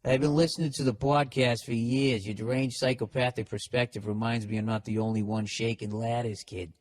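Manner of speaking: put-on broad Boston accent read in a rush. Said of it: "Is this guy from Boston?".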